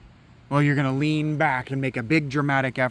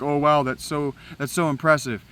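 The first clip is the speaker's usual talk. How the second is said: loud, higher mock-impressed gush; sarcastic awe; 4 semitones higher, 6 dB louder than usual. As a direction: fake being dazzled by a lame insult. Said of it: "you're like".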